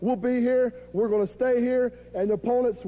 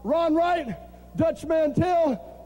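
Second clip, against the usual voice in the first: normal talking voice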